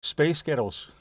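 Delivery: lightly put-on American accent. Shown as a demonstration. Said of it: "Do it in an American accent".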